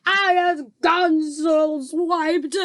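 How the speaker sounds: high, loud, very slow giant shout. A narrator's reading. Trapped giant shouts at his captors. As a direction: shout it out like an angry ogre. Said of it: "shouted the meat tripper".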